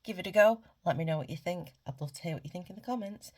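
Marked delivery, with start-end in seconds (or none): 0.0-0.6 eager urge. Real, not acted. Coach urging viewers on.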